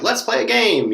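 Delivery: high, bright invitation to play; eager teacher calling a game; excited. Retold or imitated. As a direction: pitch way up like a kid hearing game time. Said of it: "you're like".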